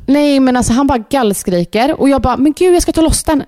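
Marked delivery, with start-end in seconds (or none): 2.4-3.4 high, urgent retold exclamation; retold panic. Mum retelling how she tried freeing her son, panicky. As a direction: re-enact the alarmed rush to help.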